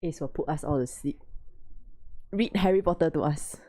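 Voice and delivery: ordinary delivery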